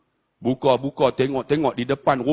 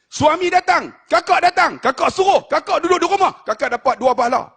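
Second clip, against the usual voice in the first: fiery preaching; 12 semitones higher than usual. Acted. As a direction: fire up the congregation. Preacher rouses the crowd.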